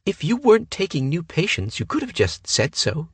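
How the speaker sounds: put-on high, sulky girlish voice; girlish pout. A narrator's reading. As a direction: pout it like a spoiled girl. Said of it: "she said with a girlish pout".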